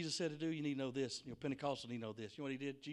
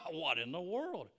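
mocking laugh into a slow, louder question; scornful laughing; 4 semitones higher, 6 dB louder, slower than usual. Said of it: "they begin to laugh him to scorn".

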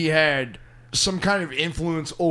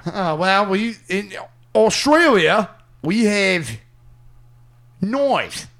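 mock Australian; 4 semitones higher, slower than usual. Friend keeps up the mock Australian comedian routine. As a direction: lecture the Americans about knives like a smug Aussie. Continuing the Jeffries impression.

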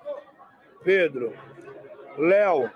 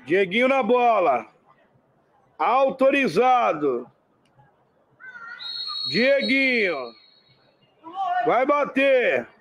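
play-by-play commentary; slower than usual. Acted. Free kick build-up commentary.

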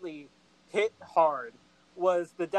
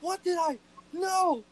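high, louder panicked oh no; realizing a fatal mistake, rising panic; panicked regret; 9 semitones higher, 5 dB louder than usual. Retold or imitated. Burr realizing what he did, high panicked regret. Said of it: "you can tell he's just like".

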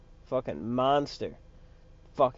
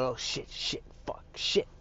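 hushed, nervous cursing under the breath; mock nervous swearing, very soft; imitated nervousness; 8 dB quieter than usual. Retelling the shooter fumbling at his guns, nervous mutter. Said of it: "You know, he's nervous".

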